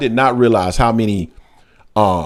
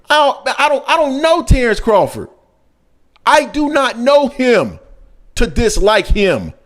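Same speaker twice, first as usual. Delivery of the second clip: indignant; 9 semitones higher than usual. Real, not acted. Indignant denial.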